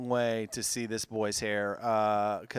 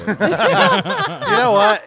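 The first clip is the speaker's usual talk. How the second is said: laughing exclamation; 13 dB louder, much slower than usual. Real, not acted. Stretched exclamation while laughing.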